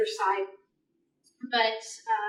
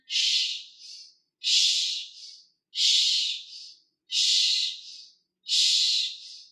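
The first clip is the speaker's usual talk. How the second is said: long hissing shh breaths out; guiding breath shush sounds; exhale shush; much slower than usual. Shown as a demonstration. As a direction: blow the breath out with a steady shush. Breath cue during pulse counts.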